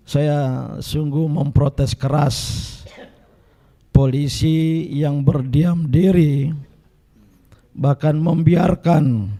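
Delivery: slow, firm, every word weighted; slow and deliberate protest; emphatic protest. Real, not acted. Speaker formally protesting police inaction.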